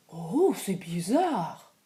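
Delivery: plain reading voice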